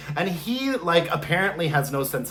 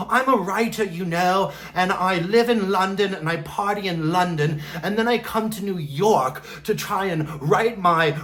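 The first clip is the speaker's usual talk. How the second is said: crotchety British man; 3 semitones higher than usual. Retold or imitated. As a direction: put on a fussy British accent and complain. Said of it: "this crotchety old British man".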